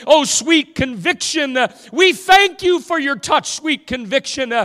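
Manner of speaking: high, loud revival preacher in full flow; impassioned preaching. Real, not acted. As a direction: preach it with mounting urgency to the congregation.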